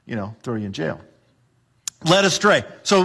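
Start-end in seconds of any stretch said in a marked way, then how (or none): 0.0-1.0 low, softer, menacing authority voice; a hint of a jailer muttering a threat; mock persecutor voice. Preacher voicing persecutors threatening jail, low and soft. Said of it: "and they say".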